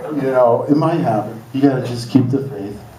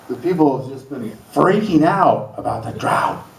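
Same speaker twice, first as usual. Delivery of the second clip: ordinary talk, nothing marked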